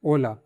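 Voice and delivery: ओला starts straight on the o, no h sound; ओला said with a silent h. Shown as a demonstration. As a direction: open ओला on the vowel. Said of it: "यहाँ से चालू कर देंगे".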